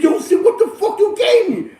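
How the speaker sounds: high, laughing imitation of a furious buyer; mock angry caller. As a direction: play the cheated buyer, half laughing. Caller angry about bad product.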